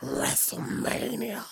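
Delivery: slow, hoarse, drawn-out WrestleMania; strained lost voice. Real, not acted. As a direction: push WrestleMania through a worn-out throat. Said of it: "my voice is gone".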